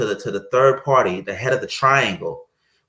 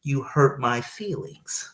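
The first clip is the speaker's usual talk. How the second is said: much softer and slower, a touch vulnerable; soft admission; 7 dB quieter, slower than usual. Retold or imitated. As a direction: say it quietly, almost shy to admit it. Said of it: "you feel a little soft saying".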